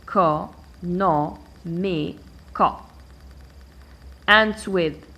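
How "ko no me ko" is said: e, ko, no, me, ko as five separate beats; divided into syllables. Shown as a demonstration. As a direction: pause after every piece of "e, ko, no, me, ko". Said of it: "I start by dividing".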